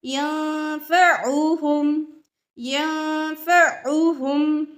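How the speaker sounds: यंफउहुम slow with rounded lips on उ; slow example word. Shown as a demonstration. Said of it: "होंठ गोल होंगे". (यंफउहुम धीरे, होंठ गोल करके)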